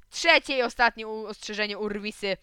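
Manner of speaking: loud warning bellow; stern shout. A narrator's reading. Teacher yelling a final warning at fighting boys. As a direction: call the rascals to order. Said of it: "krzyknął Rosół".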